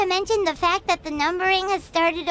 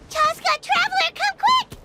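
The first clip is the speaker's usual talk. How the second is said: gasp, then call out high and urgent; 8 semitones higher than usual. Acted. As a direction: hurry them over with rising urgency. Finds notebook, calls companions over.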